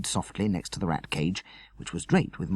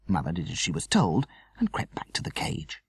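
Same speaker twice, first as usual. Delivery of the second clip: ordinary delivery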